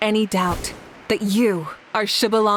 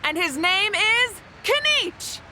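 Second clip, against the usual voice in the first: much higher, ringing proclamation; triumphant announcement; 12 semitones higher than usual. Acted. Announcer proclaiming the champion to the crowd. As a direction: crown the champion before the crowd.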